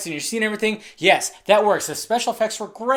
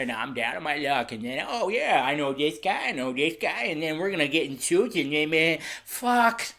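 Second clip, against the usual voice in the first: lower, softer mumbling Sandler parody; mock Adam Sandler; 6 semitones lower, 7 dB quieter than usual. Mocking Adam Sandler's character.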